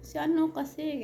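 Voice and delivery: high, tearful sob-voiced plea; choked up between sobs; crying. Crying retelling to neighbor. (umiiyak na pagsasabi)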